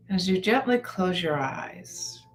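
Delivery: unhurried guided-meditation delivery with long pauses; calm, measured phrases, letting each one settle. Acted.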